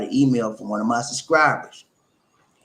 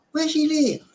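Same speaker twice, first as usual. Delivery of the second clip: high falsetto, prying aunt; high-pitched questioning auntie; nosy auntie. Acted. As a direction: quiz him in a high auntie voice. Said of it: "my auntie".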